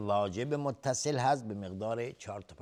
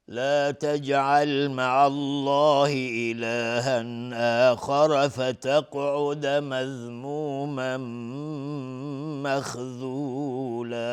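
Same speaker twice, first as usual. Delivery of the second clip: slow melodic tajwid chant, a bit higher; Quran recitation; 3 semitones higher, 6 dB louder, much slower than usual. Acted. Teacher reciting the next verse before its rules. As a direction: intone the verse in measured tartil.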